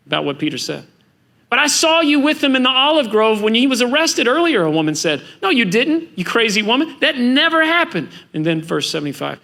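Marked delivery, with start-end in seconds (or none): 1.5-4.6 higher-pitched, pressing accusation, acted out; insistent accusation. Woman accusing Peter at the fire, insistent and higher. 5.4-8.1 high-pitched, exasperated denial, acted out; indignant denial. Peter denying the woman's accusation, indignant.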